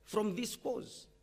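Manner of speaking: ordinary talk, nothing marked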